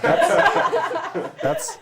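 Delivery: loud, very high laughter swallowing the word; real burst of laughing mid-word; cracking up. Real, not acted. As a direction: try to speak through helpless laughter. Teacher cracks up at wrong answer.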